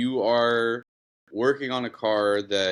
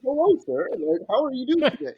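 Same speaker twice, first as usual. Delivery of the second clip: high, louder, syrupy customer-service voice; fake-sweet phone greeting; 12 semitones higher, 4 dB louder than usual. Advisor picks up for an annoying customer, high fake-sweet greeting. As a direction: switch to sugary politeness for the caller.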